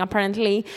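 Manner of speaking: ordinary talk, nothing marked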